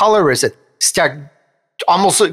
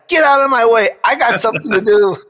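higher, forceful mock yell; playful raised-voice order; acted yell; 7 semitones higher than usual. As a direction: yell it off, half in fun. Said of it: "Sometimes you just yell at it".

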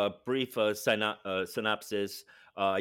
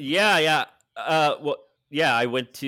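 too loud and higher; yelling; 4 semitones higher, 8 dB louder than usual. Real, not acted. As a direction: answer far louder than needed. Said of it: "You don't have to yell".

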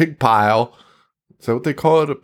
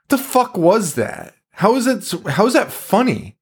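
mock disbelief; 9 semitones higher than usual. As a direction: act out a stunned audience member. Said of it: "And you're like".

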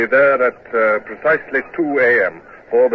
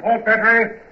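shouted command; 12 semitones higher, slower than usual. Acted. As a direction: bark the order to fire. Ordering battery to fire.

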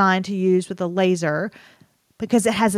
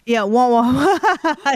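muffled wah wah talk; 4 semitones higher, slower than usual. Retold or imitated. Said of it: "that Charlie Brown".

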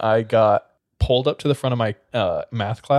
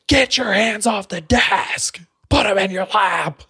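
angry teacher; 8 semitones higher, slower than usual. Retold or imitated. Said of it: "he's screaming at my face".

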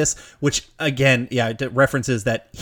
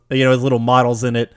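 ordinary talk, nothing marked

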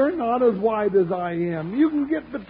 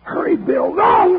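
urgent yell; 3 semitones higher, 7 dB louder than usual. Acted. Deputy to his partner in a gunfight, urgent yell. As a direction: yell out in alarm, building fast.

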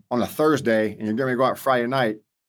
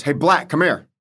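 a raised call across the deck, pitched up and louder; retold yell; 4 semitones higher, 5 dB louder than usual. Company gunny calling across the flight deck, a moderate retold yell. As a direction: holler his name across the flight deck.

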